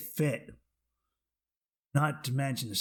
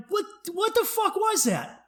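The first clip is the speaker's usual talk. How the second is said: very high, baffled stammered question; retold bewilderment; 3 dB louder than usual. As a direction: replay disbelief way up in pitch. Acted baffled reaction, repeated.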